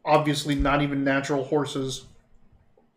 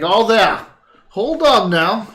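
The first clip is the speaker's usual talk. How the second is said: rider's call; 6 semitones higher, 7 dB louder, slower than usual. Acted. GM voices rider greeting party.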